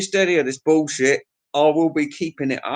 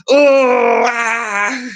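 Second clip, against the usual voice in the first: mock ecstatic cry; 7 semitones higher, 5 dB louder than usual. Imagined underwater moan.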